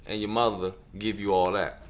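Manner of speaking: ordinary delivery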